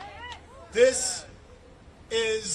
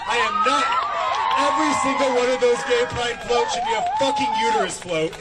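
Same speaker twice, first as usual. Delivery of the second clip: loud, raised rallying cry; loud declaration; 4 semitones higher, 10 dB louder than usual. Real, not acted. Speaker declaring about pride floats, loud. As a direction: proclaim it to the whole crowd.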